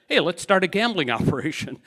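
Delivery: ordinary talk, nothing marked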